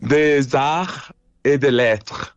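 slow, deliberate French accent; measured, ceremonial French accent. Real, not acted. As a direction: roll it out slowly in French pronunciation. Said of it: "say it in a French accent".

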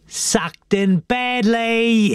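high, drawn-out taunt; singsong boyish triumph; gloating. A narrator's reading. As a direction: crow over fooling your mate. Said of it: "he gloated".

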